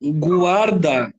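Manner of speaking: guarda said slowly, the U sounded as gwar-da; slow guarda with a clear gw; spoken example. Shown as a demonstration. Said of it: "se eu falar assim".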